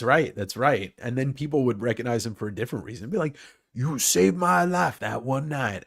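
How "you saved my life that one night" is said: slow, drawn-out mock Southern drawl; higher, hammed-up Southern accent; Foghorn Leghorn. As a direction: stretch it into a cartoon Southern drawl. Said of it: "everybody talks like Foghorn Leghorn".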